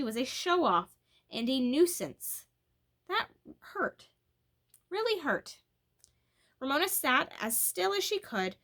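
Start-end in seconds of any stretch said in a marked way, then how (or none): 3.1-5.6 hurt. A narrator's reading. Narration of Ramona's pain.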